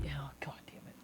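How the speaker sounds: whispered curse. Real, not acted. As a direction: breathe it out under breath.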